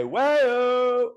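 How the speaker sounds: high, drawn-out Wayo called across the yard; mock a mom calling Wayo down the street; mom bellowing Wayo. Mom calling her son in for dinner with a bellowed Wayo. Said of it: "my mom would say".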